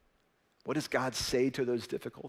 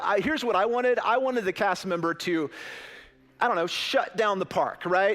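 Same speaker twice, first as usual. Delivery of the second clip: worked-up retelling; 6 semitones higher, 5 dB louder than usual. Real, not acted. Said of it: "recalls panic, loud and high".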